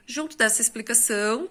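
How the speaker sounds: normal talking voice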